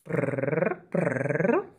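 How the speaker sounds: soft rolled purr; cat purring. Retold or imitated. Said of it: "Tenés un motorcito".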